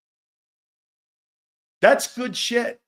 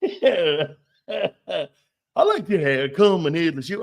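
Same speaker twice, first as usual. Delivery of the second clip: fake Cajun accent; 4 dB louder than usual. Host mocking a coach's recruiting line, fake Cajun accent. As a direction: repeat the pitch in a phony Cajun accent. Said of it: "phony-ass accents".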